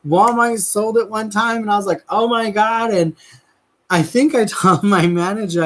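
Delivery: high, exasperated rant about the candy. Real, not acted. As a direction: throw up your hands over the forgotten name.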